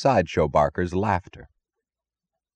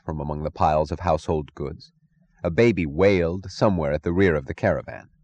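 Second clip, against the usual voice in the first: ordinary delivery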